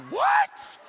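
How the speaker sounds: high, loud incredulous what; laughing, high-pitched shock. Retold or imitated. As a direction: squeak it out in disbelief. Shocked reaction to the prison picture.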